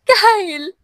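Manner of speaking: high, anguished cry of his name; desperate shout from her knees. A narrator's reading. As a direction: call after him in despair. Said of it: "Sigaw ni Angela na napaluhod".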